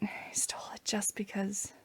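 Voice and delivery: soft unvoiced whisper; whispered grumble. Real, not acted. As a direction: hiss it under your breath. Grumbles aside.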